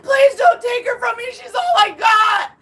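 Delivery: high, loud tearful begging; desperate pleading. Retold or imitated. Begging ex on her knees, desperate tearful plea. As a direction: cry out the plea, reliving it. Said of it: "and I prayed".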